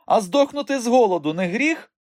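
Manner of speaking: very high, loud challenge; defiant retort. A narrator's reading. Hungry boy arguing with uncle, defiant. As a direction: throw the question back stubbornly.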